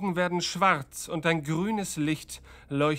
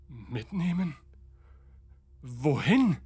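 fearful; 2 semitones lower than usual. Acted. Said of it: "ängstlich".

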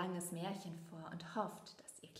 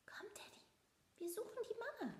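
high, hushed little mouse; sneaking off, trying not to be heard; whispered child voice; 7 dB quieter, much faster than usual. A narrator's reading. Leo softly urges his teddy to go find Mama.

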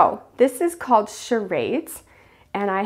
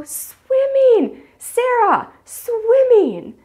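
very high, loud squealed guesses; high-pitched kid cheer; excited children guessing; 13 semitones higher, 5 dB louder, much slower than usual. Acted. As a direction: shriek the answer like thrilled preschoolers. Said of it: "their friends will go".

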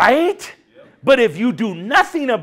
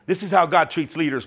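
usual speaking voice

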